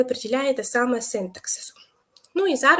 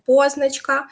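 "позначка" with a strong ПО; stress on first syllable; 2 semitones higher, 5 dB louder, slower than usual. Shown as a demonstration. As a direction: hit the opening ПО of "позначка" firmly. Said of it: "наголос на перший склад".

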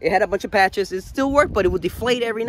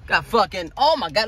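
normal talking voice

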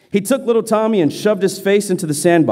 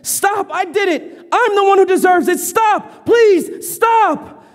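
frantic begging; 12 semitones higher, slower than usual. Acted. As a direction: cry out to make it stop. Boy begs through tears.

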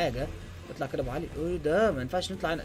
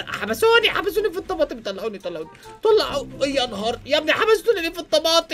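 panicked shouting; 8 semitones higher, 5 dB louder than usual. Real, not acted. Trapped in game, panicking.

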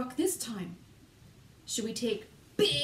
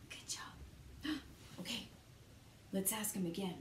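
softly whispered praise; 4 semitones lower, 15 dB quieter than usual. Acted. Teacher hushes the class while sneaking up, whispering. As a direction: keep it hushed so the wolf won't hear. Walking quietly toward the wolf.

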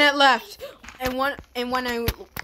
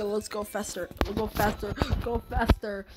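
ordinary talk

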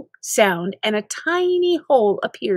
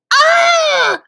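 panicked scream; 7 dB louder than usual. Acted. Keeps screaming.

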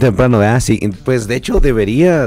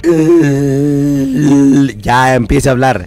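higher, louder stammering crooner voice; 5 semitones higher, 5 dB louder, much slower than usual. Retold or imitated. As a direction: stumble through it like a croaky old singer. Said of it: "señor [name], hable bien".

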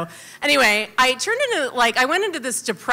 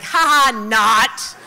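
sarcastic mockery; 5 dB louder, slower than usual. Mocking her own old journal.

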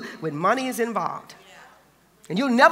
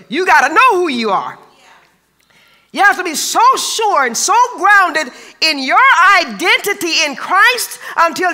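higher, louder, driving sermon voice; full-force preaching; 7 semitones higher, 6 dB louder than usual. Acted. Preacher urging the congregation, louder and higher. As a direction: preach it up to the rafters.

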